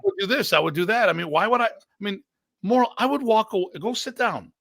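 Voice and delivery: high, worked-up tirade at a rival; a high, incredulous rant at a rival fighter; heated rant. Retold or imitated. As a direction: get fired up and threaten him, pitched high.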